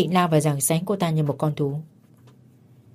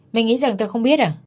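higher, sharp jealous jab; angry accusation; 6 semitones higher than usual. Acted. Jealous husband confronting his wife, angry and higher. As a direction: throw the accusation at her.

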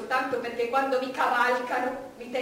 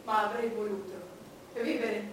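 ordinary delivery

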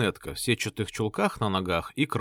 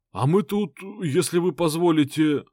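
meek pleading thug; 6 semitones higher, 4 dB louder than usual. Acted. Thug pleads meekly, higher voice.

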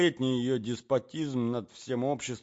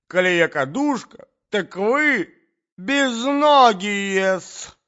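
very high, loud quarrel shriek; screeching offended noblewoman; outraged Natalya; 11 semitones higher, 13 dB louder than usual. A narrator's reading. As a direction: scold the prince at full voice.

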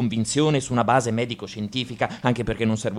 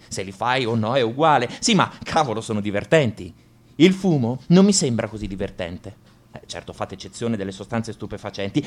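ordinary delivery

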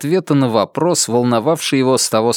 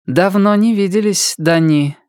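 higher-pitched voice of a disguised woman; changed woman's voice; 8 semitones higher than usual. A narrator's reading. Emilia greets long-lost Dani in a changed voice, pitched higher. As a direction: greet him in an altered, higher voice. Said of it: "произнесла Эмилия Шан голосом не Эмилии Шан".

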